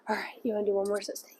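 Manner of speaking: breathy whispered question to the puppy. Real, not acted. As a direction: whisper it gently, close to her.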